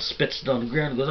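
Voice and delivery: ordinary talk, nothing marked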